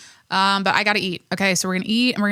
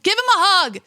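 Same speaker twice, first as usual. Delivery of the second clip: emotional plea; 10 semitones higher, 5 dB louder than usual. Retold or imitated. Distressed watching crying kid.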